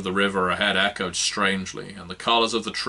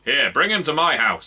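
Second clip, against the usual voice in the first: another voice; 6 semitones higher, 6 dB louder than usual. Acted. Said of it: "said another voice".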